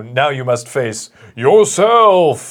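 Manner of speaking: hammed-up slow booming announcement. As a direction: intone it like a final trial. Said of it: "the booming voice is like".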